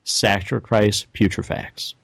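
slow, drawn-out reveal; slow roll. Shown as a demonstration. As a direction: drag out the last part. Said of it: "Can you slow roll the last part of the card?".

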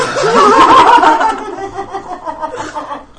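loud burst of laughter; laughing hard; big laugh. Real, not acted. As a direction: crack up. Laughing at own story.

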